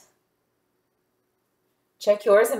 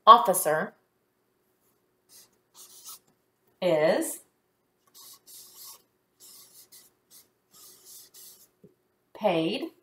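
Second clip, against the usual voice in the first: single words with very long pauses; isolated words, long silences between; dictating while writing; 4 dB quieter, slower than usual. Shown as a demonstration. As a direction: release one word at a time.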